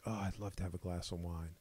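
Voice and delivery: soft, low wistful longing; quietly pining aloud; wistful sigh. Retold or imitated. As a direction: murmur it longingly to yourself. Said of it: "be like".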